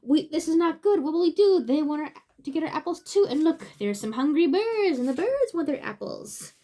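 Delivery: worried storybook voice. Acted. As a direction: fret about the hungry birds out loud.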